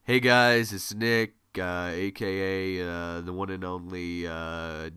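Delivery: low, dragging monotone voice; monotone demo. Shown as a demonstration. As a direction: sink into a bored, lifeless drone. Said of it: "I'm not talking like this".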